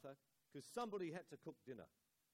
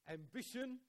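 Ambition pitched much higher and punched; stressed ambition; 9 semitones higher, 3 dB louder than usual. Shown as a demonstration. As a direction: exclaim Ambition high. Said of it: "Ambition is normally a kind of".

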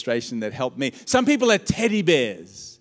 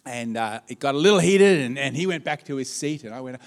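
normal talking voice

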